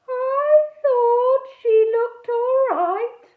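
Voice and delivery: high, loud, slow dreamy voice; dopey ghost. A narrator's reading. Edmund the ghost mooning over the girl, dreamy. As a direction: drift through it like a mooning boy ghost. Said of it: "in an irritating way".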